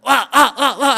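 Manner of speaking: loud high effort cries; straining grunts. Retold or imitated. Workers strain again, crying out loud. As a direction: grunt as you heave.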